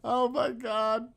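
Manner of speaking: high, loud and slow, drawn out after laughing; cracking up. Real, not acted. Streamer overcome by the shit shower shave joke, cracking up. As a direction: squeal it out, overcome by giggles.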